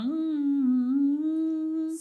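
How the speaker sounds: hummed tune, high and bright; mock humming. Therapist imitating children humming a tune. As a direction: hum a little melody like the kids do. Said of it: "E eles ficam cantarolando".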